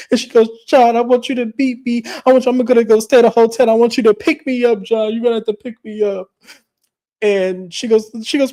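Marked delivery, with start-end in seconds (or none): 0.7-6.3 high, hurried mock wail; hammed-up demand to be rescued; distraught pleading. Lisa begs John to fetch her, distraught.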